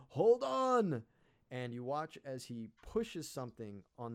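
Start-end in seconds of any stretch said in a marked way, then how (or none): 0.1-1.0 high, loud shout to hold on; shouted warning. Acted. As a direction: yell a warning to brace. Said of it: "he goes".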